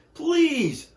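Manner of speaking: high pleading toy voices; toys crying out together. Acted. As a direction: beg the shiny engine in high little voices. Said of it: "cried out together".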